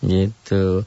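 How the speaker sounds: normal talking voice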